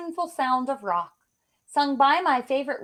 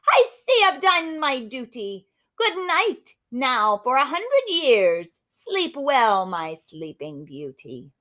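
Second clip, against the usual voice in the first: louder, slow gloating witch; 2 semitones higher, 7 dB louder, slower than usual. Acted. Witch bidding Beauty sleep a hundred years, slow gloating. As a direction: gloat slowly like a wicked witch casting a spell.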